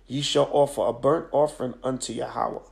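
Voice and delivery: ordinary delivery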